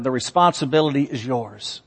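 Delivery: normal talking voice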